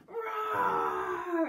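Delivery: high, long, full-volume roar; loud roar. Acted. Children roaring at a mouse, loud roar. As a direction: everyone roars at once, big and long. All the kids roar at teacher.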